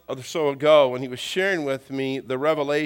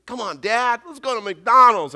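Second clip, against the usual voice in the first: put-on louder, higher pleading kid; insistent child; 8 semitones higher, 8 dB louder than usual. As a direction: imitate a boy pestering his dad. Boy insists after being refused.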